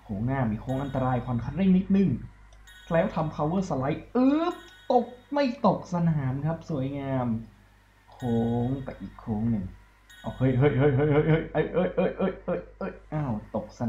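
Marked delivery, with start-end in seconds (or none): 2.9-6.5 tense commentary. Real, not acted. Tense slide near track edge. 10.2-13.8 laughing, rapid hey hey as the car slips; panicked laugh while losing the car; giggling alarm as the car falls. Real, not acted.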